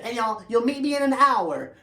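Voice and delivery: eager to get it right; eager horse voice. Acted. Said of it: "you can know that Tempo is talking".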